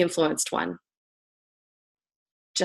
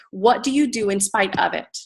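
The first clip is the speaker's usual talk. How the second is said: usual speaking voice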